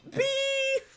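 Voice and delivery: very high, loud beeping appliance; microwave voice. Pretend. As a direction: beep like a kitchen microwave. Pretending to be a microwave.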